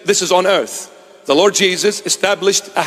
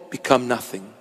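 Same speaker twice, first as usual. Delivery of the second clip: ordinary delivery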